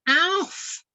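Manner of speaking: high eager call across the water. Acted. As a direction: hail a friend from above. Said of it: "he cried".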